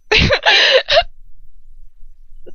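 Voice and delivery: high, helpless burst of laughing; real cracking up mid-sentence; laughter. Real, not acted. Reader breaks up mid-story.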